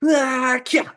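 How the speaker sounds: high falling cry ending in "kya"; high "ah", then a sharp landing noise; imitated drop and landing. Shown as a demonstration. Showing how two beings would drop, imitated cry. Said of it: "they would drop down like this".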